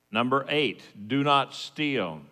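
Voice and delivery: normal talking voice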